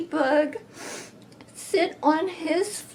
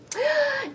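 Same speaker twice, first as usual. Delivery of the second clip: sharp high gasp; a big surprised gasp for the kids; 13 semitones higher, 4 dB quieter than usual. Acted. Storyteller gasping to children, high and breathy.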